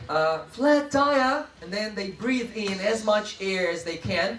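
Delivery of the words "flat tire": loud, high call-out; raised classroom cue; attention-getter call. Shown as a demonstration. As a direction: call it sharply to the whole class. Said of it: "the one I like".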